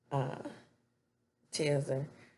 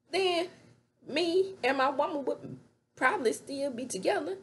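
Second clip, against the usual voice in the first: much higher, crying, shouted blame; mock tearful rage; 14 semitones higher than usual. Zach blaming Nate for losing his woman, crying mock rage. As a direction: rail at him with a breaking voice.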